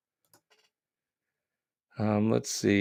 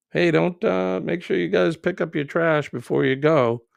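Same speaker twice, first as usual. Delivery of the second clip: higher, friendly ranger calling out to beachgoers; a light put-on ranger voice; pretend park ranger; 7 semitones higher, 3 dB louder than usual. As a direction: play the ranger telling tourists to clean up. Host imagines being a park ranger.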